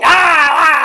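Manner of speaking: loud grunting shout; shouted wrestler grunt. Retold or imitated. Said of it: "he was pretty much grunting".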